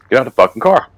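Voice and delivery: forceful mock cop command; retold cop's order. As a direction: bark it a little, as the retold cop.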